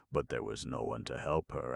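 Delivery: ordinary delivery, nothing marked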